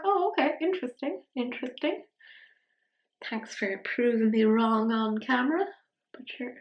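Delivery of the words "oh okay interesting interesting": higher, bemused mock surprise; reacting to a surprisingly strong shadow, mock surprise. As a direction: raise the pitch in amused disbelief. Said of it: "and I was like".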